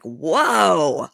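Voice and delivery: drawn-out mock shock; shocked group reaction. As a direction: imitate a crowd taken aback. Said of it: "they're all like".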